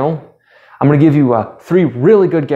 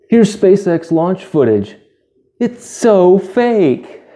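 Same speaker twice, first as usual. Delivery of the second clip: high, slow, mocking conspiracy voice; high and drawn-out, mocking a hoax video host; hoax-believer skit voice; 7 semitones higher, slower than usual. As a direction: sneer it's all fake like a Russian hoax vlogger. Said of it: "I'm Russian vids".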